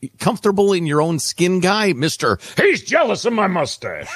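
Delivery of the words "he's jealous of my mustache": mock smug guy. Mocking beard owner's excuse.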